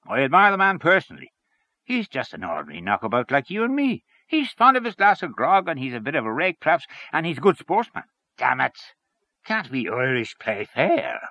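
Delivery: high, emphatic character voice; animated, swinging pitch; hearty, opinionated Henchy. Acted. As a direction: plead his case with blustering gusto. Voices Henchy defending the king.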